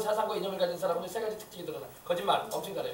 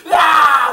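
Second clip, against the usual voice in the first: very loud, high-pitched shriek; demonic scream; 8 semitones higher, 17 dB louder, much slower than usual. Shown as a demonstration. Demon screaming in fear of Jesus, loud terrified shriek. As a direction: scream out at full blast. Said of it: "비명을 지른다는 거야".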